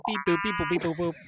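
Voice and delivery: high, bouncy beep-boop noises; toy robot voice. Pretend. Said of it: "You didn't need to make the sound".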